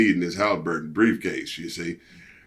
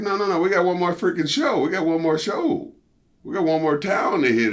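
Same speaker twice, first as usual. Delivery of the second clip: pleading protest; 7 semitones higher than usual. Retold or imitated. Retold protest, pitch raised.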